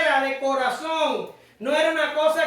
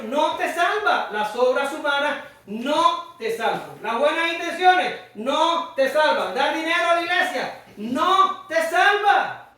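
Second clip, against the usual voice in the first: slow, hammered, raised preaching; shouting; slower than usual. Real, not acted. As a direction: pound each "no te salva" home, slowly. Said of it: "ya sé que estoy gritando". (gritando "no te salva" despacio y con fuerza)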